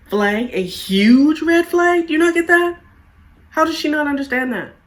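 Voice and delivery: high, loud disbelieving rant at the screen; exasperated rant. Retold or imitated. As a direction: pitch it way up in outraged disbelief. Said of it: "And I'm thinking".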